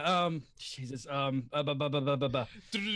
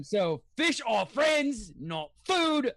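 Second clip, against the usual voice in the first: hammed-up angry shark; Bruce; 5 semitones higher, slower than usual. Player voicing the shark turning on his prey, angry. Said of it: "that's just angry Ky-, [name]".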